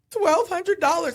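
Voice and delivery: sheepish confession. Retold or imitated. Wife owning up to her husband about the lost money, sheepish. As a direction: confess the number, bracing for it.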